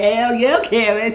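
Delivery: loud, high hell yeah; excited cheering. Retold or imitated. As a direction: whoop it up for a friend. Cheering Karen on, laughing after.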